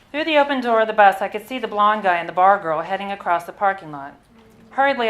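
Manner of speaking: ordinary talk, nothing marked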